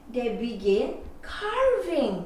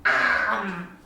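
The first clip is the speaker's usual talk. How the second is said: plain reading voice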